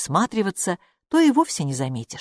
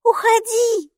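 put-on urgent child hiss; 5 dB louder, slower than usual. A narrator's reading. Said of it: "шепнула Майки".

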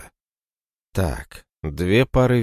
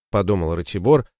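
plain reading voice

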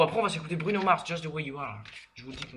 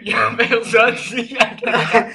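laughing imitation; 4 semitones higher, 6 dB louder, slower than usual. Mimics earlier exchange, laughing.

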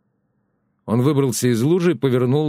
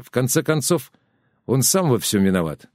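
ordinary delivery, nothing marked

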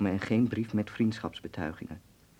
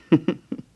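short laugh; 9 semitones higher, 9 dB louder than usual. Acted. Hans laughs at his joke.

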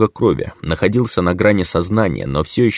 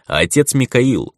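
usual speaking voice

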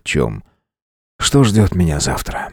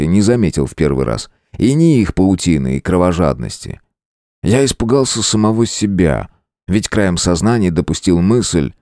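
ordinary delivery, nothing marked

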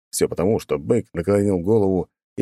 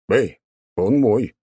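possessive protest; 3 semitones higher, 3 dB louder, slower than usual. Acted.

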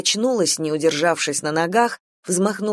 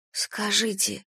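soft, hesitant approach; quiet question; 3 semitones higher, 7 dB quieter, slower than usual. A narrator's reading. Kira asking the old woman, quietly. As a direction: ask it softly, carefully. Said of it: "тихо спросила Кира".

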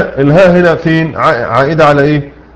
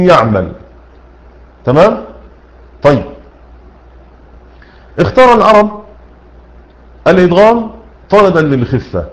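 ordinary talk, nothing marked

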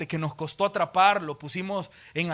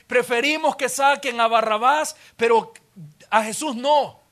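louder, higher, insisting hard on no; indignant demand; 4 semitones higher, 7 dB louder than usual. Acted. Religious leaders insisting to Pilate, loud and firm. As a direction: push the crowd's demand home.

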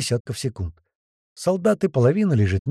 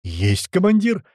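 high, slow military reply; called-out acknowledgement; 6 semitones higher, 3 dB louder, slower than usual. A narrator's reading. Gunner confirms his commander's order, calling back. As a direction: answer the commander smartly in a raised voice.

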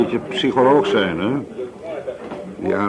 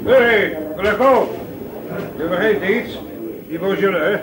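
calling after; 9 semitones higher than usual. Acted. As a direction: stop him at the door. Barman calls after leaving customer.

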